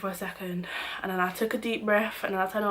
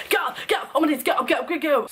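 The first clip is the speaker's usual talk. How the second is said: high, loud mock yelling; shouted orders, pitched well up; bouncers screaming; 7 semitones higher, 3 dB louder, faster than usual. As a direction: bark it over and over like angry bouncers. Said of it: "five bouncers screaming at me".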